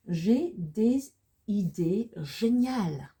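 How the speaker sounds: slow, loud, drawn-out French with swooping pitch; slow model reading. Shown as a demonstration. Teacher presenting a French sentence to learners, slow model reading.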